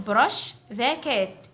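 put-on very slow, clear drill phrase for children to repeat; slow model phrase. Shown as a demonstration. Teacher to fifth graders, model phrase very slowly. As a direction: stretch each word out for the class. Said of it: "Repeat after me".

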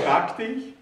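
ordinary delivery

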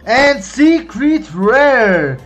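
normal talking voice

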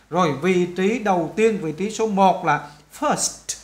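ordinary talk, nothing marked

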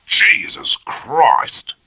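slow, strained cry of pain; groaning in pain. Acted. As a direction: force it out through sudden pain. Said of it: "gave a groan".

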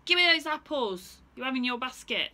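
bully him for apples; bossy kangaroo. Acted. Kangaroo demands apples from Stephen, loud and bossy.

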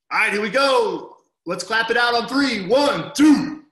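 rally the class for the clap; calling out to class. Real, not acted. Coach rallying students to clap, loud call.

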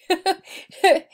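high, loud laugh; high burst of laughter. Real, not acted. Vlogger laughing at her own panic. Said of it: "I'm laughing now".

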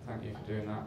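low-key, softly spoken awkward gratitude; awkward thanks. Retold or imitated. Embarrassed man thanking the worker who retrieved his keys, awkward and subdued. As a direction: thank him sheepishly, keeping it soft. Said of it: "I thanked him awkwardly".

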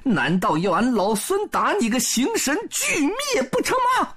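laughing, then high-pitched threat; laugh, then demand surrender up high; shouted challenge. A narrator's reading. Sun Wukong blocks Bull King's escape, high taunting shout. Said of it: "孙悟空大叫一声".

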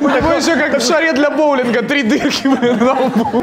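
high giggly delivery; laughing. Real, not acted. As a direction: talk through the giggles. Laughing joke, high pitch.

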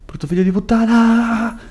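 grido acuto, ultima vocale tirata; insulto rabbioso. Real, not acted. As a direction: inveisci contro la bestia con voce acuta. Insulta lo squalo dopo lo spavento. (rabbia acuta e strascicata)

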